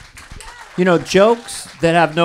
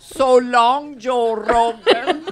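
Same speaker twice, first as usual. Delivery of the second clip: ad-read impression; 3 semitones higher, 4 dB louder than usual. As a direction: bid rivals a mock-grand goodbye in character. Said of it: "Dana does impressions in most of the ads".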